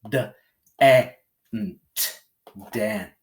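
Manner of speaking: "dent" sound by sound with a quick eh; short E. Shown as a demonstration. Teacher reading a closed syllable, short eh in "dent". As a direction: sound out "D, e, n, t", then blend "dent". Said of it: "read it with a short E".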